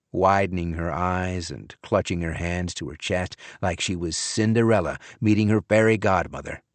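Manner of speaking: ordinary delivery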